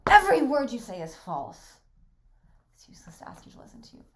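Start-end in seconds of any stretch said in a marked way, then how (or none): 2.8-4.0 quick unvoiced whisper; hushed rushed aside. Real, not acted. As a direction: mutter it under the breath, fast. Whispered aside between lines.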